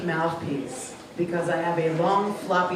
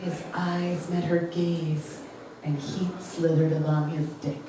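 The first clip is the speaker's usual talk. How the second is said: slow, soft, lower seductive read; sultry romance narration; 4 semitones lower, 5 dB quieter, slower than usual. Shown as a demonstration. Comic reading her favourite Harlequin line in a slow sultry voice. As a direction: purr it like an audiobook for grandmothers. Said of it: "So my favorite line was".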